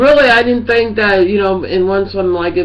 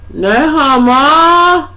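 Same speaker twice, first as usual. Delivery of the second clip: higher, louder, slow disbelief; incredulous drawn-out question; 8 semitones higher, 6 dB louder, much slower than usual. Retold or imitated. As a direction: stretch it out in disbelief. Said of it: "I'm like".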